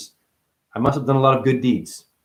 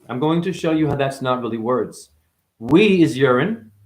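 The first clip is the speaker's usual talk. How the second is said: usual speaking voice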